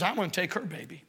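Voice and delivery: hushed, quick, like a secret plan; sinister plotting. Retold or imitated. Preacher voices the grieving harlot plotting theft, sinister and hushed. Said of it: "a sinister idea comes into her head".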